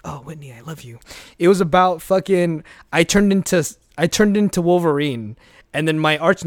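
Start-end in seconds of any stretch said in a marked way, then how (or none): 0.0-1.0 dramatic love line. Retold or imitated. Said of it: "like, a dramatic".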